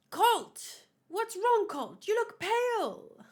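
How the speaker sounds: high, loud cry of alarm; panicking over her son's pale face; alarmed mother. Acted. Mother spots her son looks pale, cries out in alarm.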